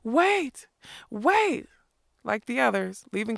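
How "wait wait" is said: Molly cries out to the departing legends; crying out. A narrator's reading. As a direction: cry after them as you run.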